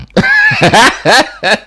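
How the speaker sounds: a burst of high, loud laughter; high delighted laugh. Real, not acted. Host laughs after powerful talk.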